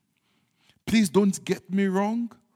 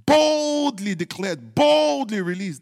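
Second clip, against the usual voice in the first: slow, ringing proclamation, building higher and louder; bold declaration; 4 semitones higher, 6 dB louder, slower than usual. Real, not acted. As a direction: stretch BOLDLY and hit it hard. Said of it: "the child of God is bold".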